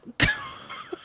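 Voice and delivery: shrill burst of laughter; high cackle. Real, not acted. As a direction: cackle in disbelief. Laughs at being blocked.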